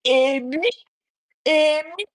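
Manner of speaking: high, loud exclamation of hurt; acted parental lament. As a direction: cry it out high like a wronged parent. Acts parent's wounded exclamation.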